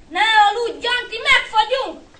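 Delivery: high, loud, desperate; tearful urgent alarm; panicked cry. A narrator's reading. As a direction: shout your brother awake in terror. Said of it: "Ugrott fel. Sírva, dögönyözte".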